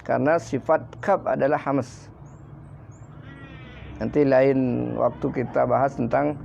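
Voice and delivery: usual speaking voice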